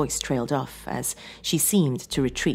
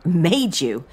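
loud, higher incredulous question; raised-voice disbelieving interruption; indignant interjection; 4 semitones higher, 7 dB louder than usual. A narrator's reading. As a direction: cut in, taking offence. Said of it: "John interjected".

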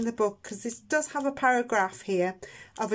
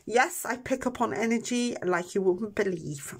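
ordinary talk, nothing marked

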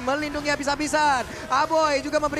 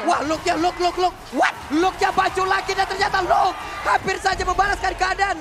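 higher and louder hype call; excited shout; 5 semitones higher, 5 dB louder than usual. Real, not acted. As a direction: rise to a thrilled shout. Caster reacting to a comeback.

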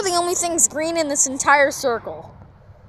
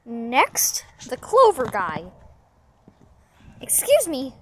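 ordinary talk

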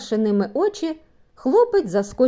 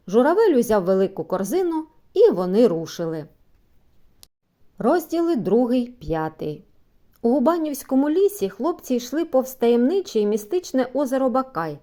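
usual speaking voice

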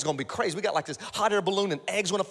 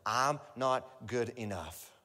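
slow excuse; 6 semitones lower, 6 dB quieter, slower than usual. Retold or imitated. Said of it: "Here's the first one".

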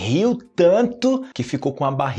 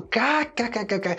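imitated laugh; 8 semitones higher, 4 dB quieter than usual. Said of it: "e todo mundo".

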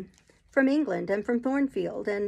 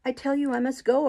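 higher and louder, fierce insistence; passionate retort; 5 semitones higher, 5 dB louder than usual. Acted. Jane retorts to Rochester, roused to passion. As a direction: fire it back at him with passion. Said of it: "I retorted, roused to something like passion".

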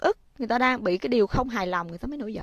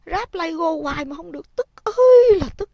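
higher, much louder cry of frustration; mock frustration; 8 semitones higher, 9 dB louder than usual. Shown as a demonstration. Mother voicing the child's frustration with a cry. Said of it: "dạy cho nó cách nói ra tình cảm".

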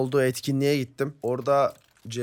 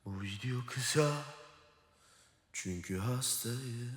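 mock solemn explanation; 10 dB quieter, slower than usual. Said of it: "neden kısa? Hemen anlatıyorum".

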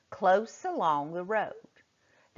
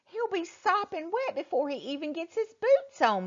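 mother voiced; 13 semitones higher than usual. A narrator's reading.

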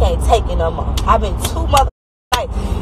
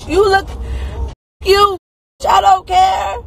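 furious shout; 11 semitones higher, slower than usual. Real, not acted. Furious rant at a heckler.

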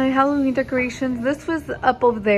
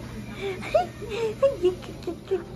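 much higher giggling laugh; squealing laugh; 11 semitones higher, 6 dB quieter than usual. Real, not acted. Mom laughs as her baby comes inside, high squealing laugh.

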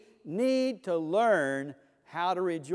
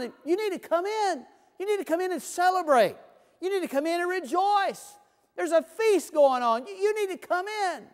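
higher, earnest pleading father's voice; father begging his angry older son, pleading and higher; 6 semitones higher than usual. Retold or imitated. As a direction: beg the son to come in, pitched up. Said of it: "the father goes out and pleads and says".

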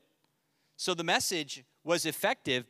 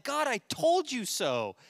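high, slow, gloating; smug told you so; 9 semitones higher, 3 dB louder, slower than usual. Retold or imitated. Jonah gloating to God, high slow smug. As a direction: drag out told like a sulky prophet. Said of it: "He's like".

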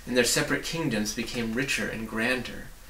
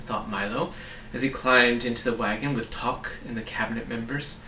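ordinary delivery, nothing marked

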